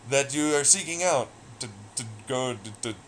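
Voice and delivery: ordinary talk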